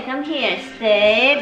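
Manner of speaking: ordinary delivery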